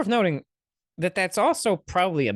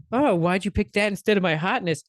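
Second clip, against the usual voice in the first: mock vain whine, a bit higher; vain hot person; 5 semitones higher, 3 dB louder than usual. Mocking a vain hot person who wants praise for their looks, mock whine. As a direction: play the offended beauty. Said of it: "or if they're like".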